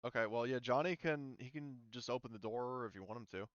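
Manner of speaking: ordinary delivery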